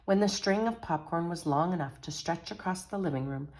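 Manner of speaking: lively picture-book read-aloud; animated storytelling to children; story narration. A narrator's reading. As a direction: read it like a bedtime story for kids.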